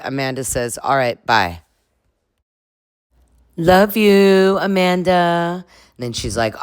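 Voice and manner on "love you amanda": high, loud, drawn-out mock love-you; mock affection. Friends signing off a call with Amanda, mock affection. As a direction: tease with an exaggerated, stretched farewell.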